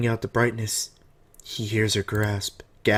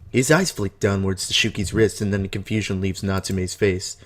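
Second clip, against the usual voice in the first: usual speaking voice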